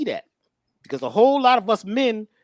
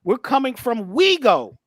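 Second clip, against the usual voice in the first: pitched up and louder, preacher's lift; fervent, higher and louder declaration; rising fervor; 7 semitones higher, 4 dB louder, slower than usual. Real, not acted. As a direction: build it like a sermon punchline. Preaching punchline about ego.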